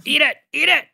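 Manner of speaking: high loud goading shout; mock shout. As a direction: egg her on, loud and high. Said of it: "We can just go".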